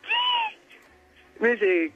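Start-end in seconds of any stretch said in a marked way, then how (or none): 0.0-0.6 very high squeak of disbelief; mock-shocked, squeal it out. Retold shock at indecent question. (chillido agudo de asombro fingido)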